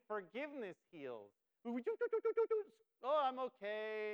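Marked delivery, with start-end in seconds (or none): 1.6-2.6 high, loud string of doo-doo-doos; playful high-pitched vocal noise; carefree doo-doo noise. Acted. Mimics carefree carrying on. 3.0-4.1 feigned okay. Acted. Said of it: "You think".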